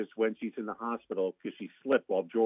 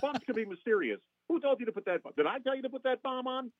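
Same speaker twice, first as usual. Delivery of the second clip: indignant high-pitched lawyer impression; worked-up rhetorical questioning, pitched high; Jackie Childs; 10 semitones higher than usual. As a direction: scold the client in a high, outraged lawyer voice. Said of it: "And Jackie".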